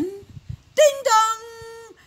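high, drawn-out chime; slow, high ding-dong; doorbell. Acted. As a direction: sing out a long doorbell note. Imitating the doorbell in a story.

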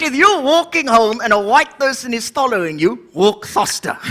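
ordinary delivery

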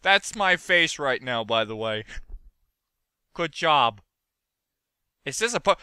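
ordinary talk

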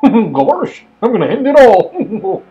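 high, loud, strained cartoon voice; a goofy cartoon mouse voice; character voice. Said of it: "That was terrible".

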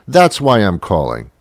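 usual speaking voice

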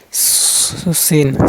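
long hissed স- leading into সিন; whistling S demo. Shown as a demonstration. Teacher showing learners how Sin sounds, স- held as a hiss. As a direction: hold the hiss of স-, then say সিন. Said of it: "সিন এমনি শব্দ করতে হবে".

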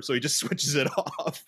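ordinary talk, nothing marked

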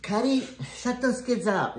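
high, sharp bossy mother voice; mother's demand. Retold or imitated. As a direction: bark the order like an annoyed mom. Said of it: "my mother would go".

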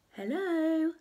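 high, sing-out hello; lightly acted high call. A narrator's reading. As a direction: call into an empty house. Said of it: "she called".

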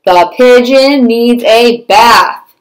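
stubborn pigeon voice. Acted. Teacher reading to children voices a stubborn pigeon. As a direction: play the stubborn pigeon, a touch whiny. Reads the pigeon's lines in character.

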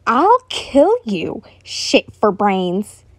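grind out the threat slowly; angry threat. Acted. Bakugo threatens friend.

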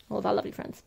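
plain reading voice

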